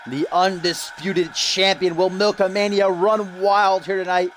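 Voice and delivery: slow, booming ring announcer build-up; ring announcer intro. Acted. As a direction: stretch out the champion's introduction for the crowd.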